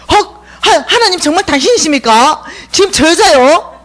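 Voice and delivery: gasp, then ask in disbelief; shocked disbelief. Acted. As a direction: Elijah asking God in shock. Elijah shocked at God's choice.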